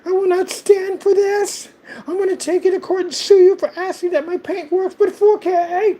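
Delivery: high-pitched indignant parody; indignant mock Karen. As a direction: ham up an offended snob. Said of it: "they snap on you".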